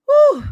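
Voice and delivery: a loud, high whew; real amused exclamation. Real, not acted. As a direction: blow out a big high whew. Reacting to Sheree's dress.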